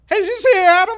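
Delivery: high, oblivious party voice; squeaky clueless question; Josh's voice. Retold or imitated. Drunk friend asking about Adam, high-pitched. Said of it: "And Josh went".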